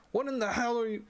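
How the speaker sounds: high, loud shocked outburst; stunned shout. Acted. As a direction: shout at something you can't understand. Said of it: "breaks off his shouting".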